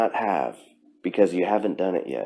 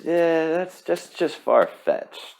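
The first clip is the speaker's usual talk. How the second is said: dismissive doubter; 9 semitones higher, slower than usual. Retold or imitated. Mocking the type of person who calls dreams far-fetched, high and dismissive. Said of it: "you could say".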